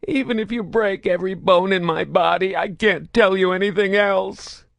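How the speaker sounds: high, strained defeated voice; weak, hopeless protest. A narrator's reading. Broken prisoner protests weakly to Saren. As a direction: protest with no hope left. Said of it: "protested weakly".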